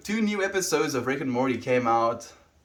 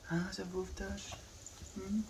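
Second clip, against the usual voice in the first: high, hushed coo to the dog; soft dog talk; 9 semitones higher, 15 dB quieter than usual. Real, not acted. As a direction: coo softly at the pet.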